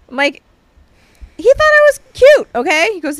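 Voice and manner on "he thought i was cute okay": high, loud mock indignation; indignant protest. Wife retelling her protest to husband, high mock indignation.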